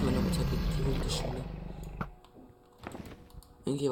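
ordinary talk, nothing marked

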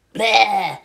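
bleating, stretched "bleh"; drawn-out childish bleh; disgusted bleh. A narrator's reading. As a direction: blurt out a yucky noise. Dirk suddenly blurts bleh in story.

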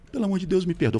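high, soft pleading voice; mock a desperate plea in a small high voice; begging partner, imitated. Exposed partner begging forgiveness, imitated high and soft.

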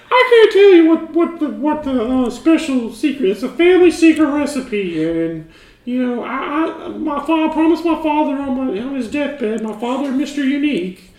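high, loud, giggly put-on voice; secretive penguin farmer guarding the family recipe, high and giggly; high character voice. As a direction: squeal it out like a nervous secretive farmer. [name], high loud laughing voice.

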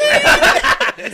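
very drawn-out high shout; loud, high-pitched comic cry; high yell. Real, not acted. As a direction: stretch the word into a laughing holler. Shouted climax over the name.

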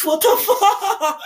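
can't get the words out for laughing; high, loud, slow laughing disbelief. Real, not acted. Cracking up at own joke.

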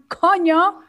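sudden high-pitched exclamation; swear word demo. Shown as a demonstration. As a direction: blurt the curse out high. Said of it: "si yo digo". (grosería soltada en tono alto)

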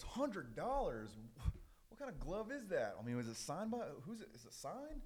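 high-pitched disbelieving questions; acted incredulity. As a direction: balk at the price, voice leaping up. Buyer balks at the glove's price.

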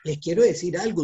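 usual speaking voice

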